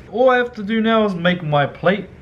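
ordinary talk

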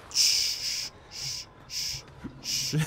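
long, unvoiced shushes; shushing. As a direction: hush someone with drawn-out shh sounds. Hushing while sneaking in game.

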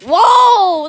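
long, loud, high whoa; amazed exclamation. Real, not acted. Watcher amazed by friend's fast run, astonished. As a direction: let out an amazed cry.

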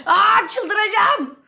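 slow, loud, high exasperated cry; frustrated yell. Real, not acted. Gamer losing a round: slow loud exasperated yell. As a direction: drag it out like you're losing your mind.

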